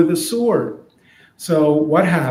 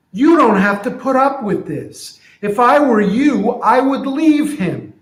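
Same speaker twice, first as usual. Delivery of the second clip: higher, insistent friend; urging friend; 8 semitones higher, 3 dB louder than usual. Retold or imitated. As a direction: friend urging the donkey to leave. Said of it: "So here's what I'm imagining".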